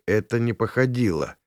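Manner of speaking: ordinary delivery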